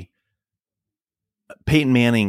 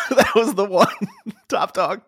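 laughing; 8 semitones higher than usual. Real, not acted. Laughing at the top dog joke.

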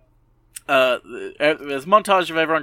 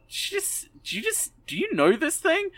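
much higher, squeaky disbelief; mock incredulous squeak. [name] stunned at sensei's slip.

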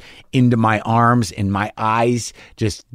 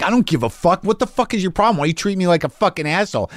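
high, heated tirade without real volume; mock screaming; 7 semitones higher than usual. Comic rages at the man snubbing him, retold high-pitched yelling. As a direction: act out losing it at someone, pitch raised. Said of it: "and I'm screaming".

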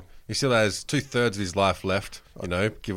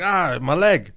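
retold crying; 6 semitones higher, 3 dB louder, slower than usual. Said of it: "he's, like, crying".

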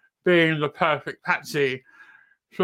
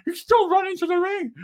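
much higher, strained mock plea at the screen; imitating himself yelling at the referee; high, incredulous fan shouting at the telly; exasperated yelling at TV; 13 semitones higher than usual. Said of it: "I'm like".

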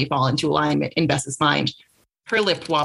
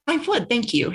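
higher, put-on posh English accent; 6 semitones higher than usual. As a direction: play the posh English lady to charm him. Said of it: "in her most posh accent".

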